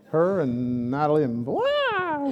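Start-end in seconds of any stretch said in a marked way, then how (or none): 1.4-2.3 high, drawn-out "blah" noise; imitated noisy outburst. Teasing how two girls bring life to the house, imitated outburst. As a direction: blurt a long, high-pitched wail of mock commotion.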